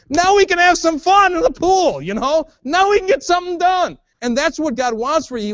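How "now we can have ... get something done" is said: put-on higher, upbeat call to a kid in the pool; cheerful parent voice. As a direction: brightly coax the child to play, lightly acted.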